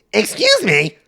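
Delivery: mock lady hailing, high and loud; calling after someone. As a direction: call after someone ignoring you in squeaky falsetto. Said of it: "'cause he's walking away, just disregarding her".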